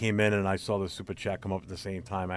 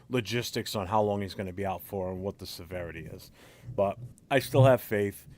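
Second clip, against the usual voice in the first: ordinary talk, nothing marked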